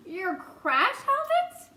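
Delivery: high, stretched-out, rising disbelief; incredulous question. A narrator's reading. Boy hears of a mouse's crash helmet, incredulous. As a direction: climb high and slow in disbelief.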